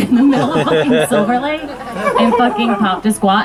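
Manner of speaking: normal talking voice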